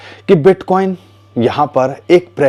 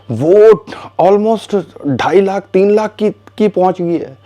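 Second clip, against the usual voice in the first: higher, drawn-out amazement at the price; amazed; 7 semitones higher, slower than usual. Real, not acted. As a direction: stretch it out, astonished. Marvelling at silver bar price. (हैरानी से, ऊँची और धीमी आवाज़ में)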